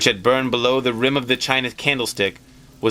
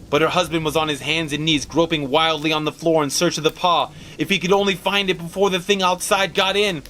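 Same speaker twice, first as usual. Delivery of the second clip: higher, urgent, building storyteller voice; tense narration; 9 semitones higher than usual. A narrator's reading. Narrator racing to the story's climax, tense. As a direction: drive the climax faster and tighter.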